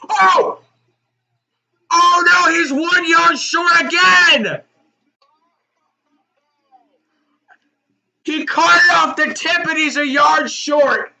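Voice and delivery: loud, high-pitched cries of disbelief; real fan yell, voice shooting way up; anguished excited shout. Real, not acted. Fan watching a Hail Mary caught a yard short, anguished shouting.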